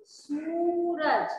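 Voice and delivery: सूरज said slowly with the long ऊ drawn out; teacher slowly modelling सूरज for the children; stretched long uu. Shown as a demonstration. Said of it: "बहुत लंबा खींच कर".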